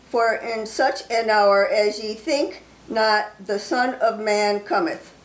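slow, solemn King James reading; scripture reading. Acted. Preacher reading scripture to his online flock, slow and solemn. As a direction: read it out like a preacher from the pulpit. Reads Matthew 24 aloud.

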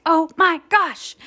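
high, drawn-out exasperated outburst; losing it. Retold or imitated. Frustrated mom snapping at her son, exasperated. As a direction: blow up, hitting each slow word. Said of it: "I lost it".

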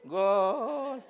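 soft, drawn-out half-hearted cheer; mock unenthusiastic goal call; limp seated cheer. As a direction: cheer as if too comfortable to get up. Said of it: "O dicen así sentadito".

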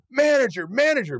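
high, sharp call across the room. Retold or imitated. Owner summons the manager across the dining room, high mock call.

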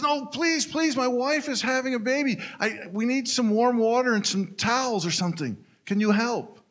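beg, voice climbing high; desperate begging. Acted. Joe pleading through the trailer door, high and desperate.

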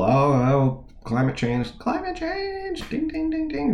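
mock alarm in a high voice, stretched into bell dings; climate change alarm bell. Host mocks the typical climate change talk, high sing-song alarm. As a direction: ring it out like a high-pitched warning bell. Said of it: "ringing the climate change bell".

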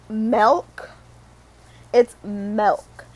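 "milk" said with an E, like "melk"; hometown pronunciation. Shown as a demonstration. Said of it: "certain words are, like, embedded in my head".

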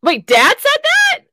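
high, loud, slow disbelief; shocked. Real, not acted. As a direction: gasp it out in shock. Hearing her dad said it.

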